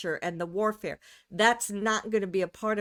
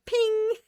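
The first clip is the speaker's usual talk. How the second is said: high sung-out "ping"; playful ping; 13 semitones higher, 4 dB louder than usual. Pretend. Imagining listeners pinging each other on Telegram, playful high ping. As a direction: mimic a ping, pitched way up.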